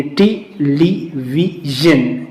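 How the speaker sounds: "Television" drawn out slowly to show its syllables; slow example word. Shown as a demonstration. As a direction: stretch television out so each part is heard. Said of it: "एक बार जो होंठ आपके". (धीरे-धीरे उदाहरण शब्द)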